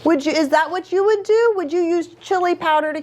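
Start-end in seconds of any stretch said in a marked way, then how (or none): none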